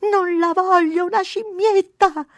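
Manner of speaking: high, slow, drawn-out wail; loud little-girl tantrum cry; child's shout. A narrator's reading. Mary refusing her brother's monkey idea, shouting. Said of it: "gridò Mary".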